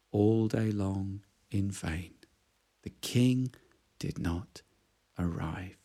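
low, soft and sinking; sad fading narration. A narrator's reading. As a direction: let it fall away in disappointment.

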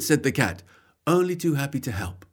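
plain reading voice

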